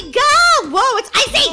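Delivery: high, loud alarmed yelling; playful panic. Real, not acted. Gamer slides on ice into water, playful panic. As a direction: yelp in panic as you slide. Sliding on icy track.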